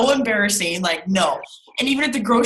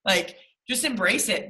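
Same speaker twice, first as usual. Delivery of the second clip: normal talking voice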